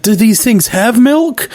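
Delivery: high, slow, puzzled mock voice; quoting a character. Retelling the cartoon to his cohost, high puzzled voice. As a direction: ask it slowly in a high, baffled voice. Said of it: "She's like".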